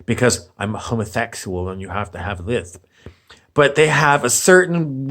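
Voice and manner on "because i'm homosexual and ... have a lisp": quick, quieter, lower put-on lisping voice; a mock lisp tossed off quickly; mocking fake lisp. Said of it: "put on a fake lisp".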